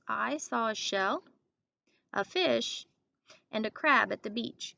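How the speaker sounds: slow read with pauses after shell and fish; pausing at commas. Shown as a demonstration. Teacher modeling pauses at the green commas. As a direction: pause wherever a comma sits. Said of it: "when I see one of those green commas, I will pause".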